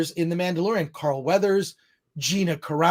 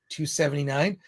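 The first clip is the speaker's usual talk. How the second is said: usual speaking voice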